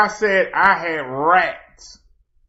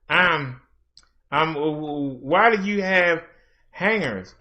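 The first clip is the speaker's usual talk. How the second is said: mock hesitant critic; 4 semitones lower, 5 dB quieter than usual. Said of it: "had the nerve to say".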